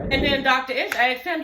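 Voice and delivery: usual speaking voice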